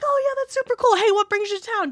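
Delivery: bright, high customer-service chirp; chirpy front desk voice. Retold or imitated. Front-desk clerk greets a guest, high and chirpy. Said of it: "I'll be like".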